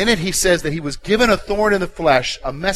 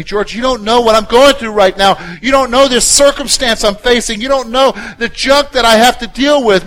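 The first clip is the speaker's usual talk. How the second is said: louder, complaining voice of a struggling listener; frustrated churchgoer; 7 semitones higher, 7 dB louder than usual. Retold or imitated. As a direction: voice the hurting listener protesting to the pastor. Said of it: "You say to me".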